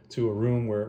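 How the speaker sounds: usual speaking voice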